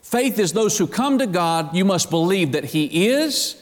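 sermon climbing toward fervor; building preacher fervor. Acted. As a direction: lift into full preaching. Rising preaching passage.

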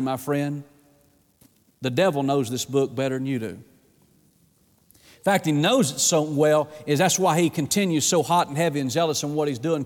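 normal talking voice

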